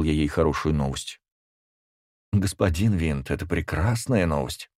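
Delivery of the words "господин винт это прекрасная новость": ordinary delivery, nothing marked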